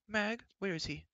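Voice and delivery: high, bustling royal chatter; fussy queen. A narrator's reading. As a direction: rattle on, scolding in passing. Queen calls steward.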